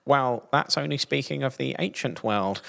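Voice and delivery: high voice for a doubting listener; put-on voice. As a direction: voice a skeptical listener. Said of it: "I like that voice".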